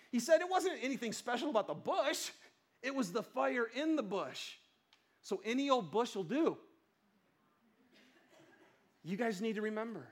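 normal talking voice

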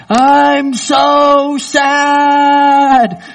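high, drawn-out mock wail of a crying child. Retelling his crying child on the drive, drawn-out mock wail. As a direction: stretch it out like a sobbing four-year-old.

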